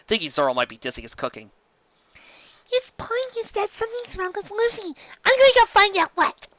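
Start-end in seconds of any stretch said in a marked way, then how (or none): 2.7-6.5 much higher, squeaky small doctor; high little Chopper. Acted. Chopper answering Sanji about Luffy, high squeaky voice. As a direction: squeak it like a tiny reindeer. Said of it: "replied Chopper".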